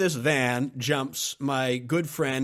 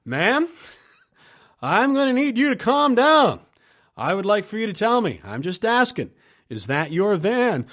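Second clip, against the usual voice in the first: higher and louder, pressing firmly for an answer; raised insistent voice of a calm man losing patience; more insistent; 8 semitones higher, 8 dB louder than usual. Retold or imitated. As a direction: push her for a reply, firm and raised. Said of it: "Jeff got a little bit more insistent".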